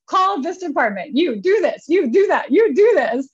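high, loud barked orders; giving orders to the crew; commanding orders. Retold or imitated. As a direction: take charge and hand out tasks. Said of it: "I just summoned the courage".

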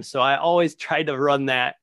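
normal talking voice

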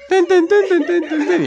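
high, loud sung violin stabs, laughing. Retold or imitated. Narrator imitating the soap opera's dramatic violins, loud and high. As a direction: belt out the dramatic violin sting.

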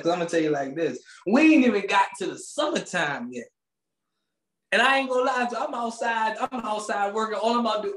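ordinary talk

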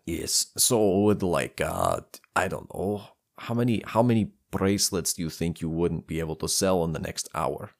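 lightly put-on dwarf voice, subdued; hesitant dwarf with a hint of an accent; Russian dwarf. Dwarf asks tutor about lost bracelet sales, lightly voiced.

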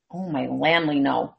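usual speaking voice

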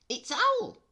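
very high, loud squeak; high cartoon mouse voice; chirpy mouse. Mouse's line in the story.

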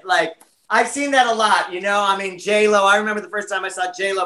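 ordinary talk

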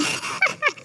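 short high laugh; high-pitched laugh. Real, not acted. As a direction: burst into a quick laugh. Laughs at the recording mix-up.

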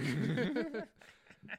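loud, high laugh. Real, not acted. Joker laughing at their own fentanyl crack. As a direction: burst out laughing.

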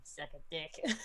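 low, soft muttered aside; under her breath. Acted. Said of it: "she whispers under her breath".

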